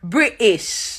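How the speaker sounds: "British" with the T dropped, as Bri'ish; British glottal stop. Shown as a demonstration. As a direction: catch the throat mid-word in "British". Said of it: "There's no T".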